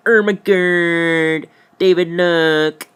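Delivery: high, loud, very slow ermagerd; mock awe. Pointing David at the horse, high slow goofy awe. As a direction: stretch it in goofy amazement. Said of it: "I'm like".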